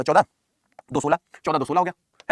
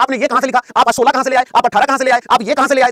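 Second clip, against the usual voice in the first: higher, louder pestering student; mock student complaint; 5 semitones higher, 6 dB louder than usual. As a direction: imitate students questioning the teacher. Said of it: "आधे बच्चे कहेंगे". (छात्रों की शिकायत की ऊँची नकल)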